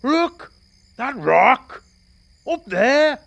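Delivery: high, loud cry of alarm; alarmed shout. Acted. Hilliard shouting to Iqbal about the man on the rock, alarmed. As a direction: shout it out as you spot the danger.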